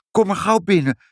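put-on high, eager child's invitation. A narrator's reading. Child invites the old man inside, high and eager. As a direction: urge him in with a high childlike voice. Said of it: "zei Ibbeltje".

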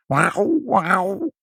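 high drawn-out cat meows; cats meowing. A narrator's reading. Cats calling from their basket, meowing. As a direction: imitate two cats wailing. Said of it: "riepen de katten in hun mand".

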